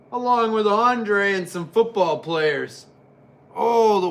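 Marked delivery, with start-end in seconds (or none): none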